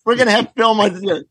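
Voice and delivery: mock triumphant. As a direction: sell it as a huge breakthrough. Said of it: "I, I just say".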